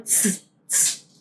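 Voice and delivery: soft hissed ts, ts; ts sound. Shown as a demonstration. Teacher demonstrating the German Z as ts. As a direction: hiss a quiet ts twice. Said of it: "como una T S".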